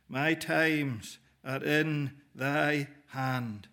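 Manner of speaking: very slow, each word weighed; reverent, drawn-out recitation of the verse; slow solemn scripture reading. Acted. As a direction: let the verse sink in slowly. Preacher quoting scripture solemnly.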